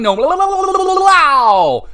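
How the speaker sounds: sing-song blah blah imitation; tossing off his catchphrases in a silly high voice; mocking Rock catchphrases. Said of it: "He didn't say".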